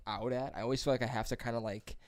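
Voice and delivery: ordinary delivery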